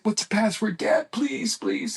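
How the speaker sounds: mock frantic begging for the passcode; retold panic. Son begging dad for the password, high imitated panic.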